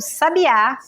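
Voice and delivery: "sabiá" with the final Á stressed; stress on last syllable. Shown as a demonstration. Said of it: "é a última sílaba". ("sabiá" com a última sílaba tônica)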